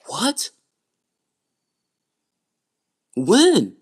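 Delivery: loud, high outburst of disbelief; shocked disbelief. Retold or imitated. Sister reacting to twin's disclosure, shocked and loud. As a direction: re-enact being stunned, voice shooting up. Shocked reply to brother's revelation.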